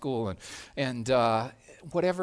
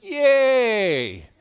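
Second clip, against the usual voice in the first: mock cheer; 6 semitones higher, 8 dB louder than usual. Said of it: "And you're like".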